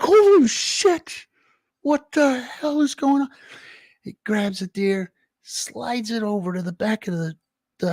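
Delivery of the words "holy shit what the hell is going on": much higher, slow, drawn-out shock; stunned disbelief. Retold or imitated. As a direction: gasp it out like you can't believe your eyes. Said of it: "I'm like".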